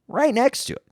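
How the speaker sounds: right next to it, high and insistent; emphatic. Retold or imitated. Showing the bathroom beside the closet, high emphatic. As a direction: pitch up, stressing how close it was.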